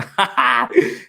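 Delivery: bursting high laugh; a quick real laugh up high. Real, not acted. Streamer laughing at a fan's warrior photo, high burst of laughter.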